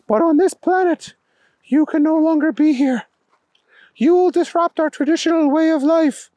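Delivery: high-pitched alien proclamation, loftily announced; alien king, solemn. A narrator's reading. Alien king banishes Booger.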